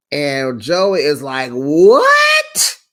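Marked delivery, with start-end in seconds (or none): 1.5-2.8 very high, loud squawk of disbelief; hammed-up squealed outburst; mock shocked shriek. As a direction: imitate him shrieking in shock. Acts out Joey's shocked reaction.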